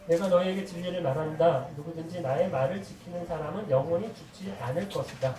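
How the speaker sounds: loud, measured verse reading; scripture recitation. Acted. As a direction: read the verse aloud firmly and evenly. Reads scripture aloud with congregation. (성경 구절을 힘 있게 낭독)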